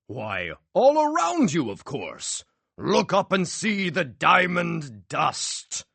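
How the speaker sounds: slow, high, theatrical flourish; grand magician. A narrator's reading. Magician revealing the trick to the crowd, grand. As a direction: savour the reveal.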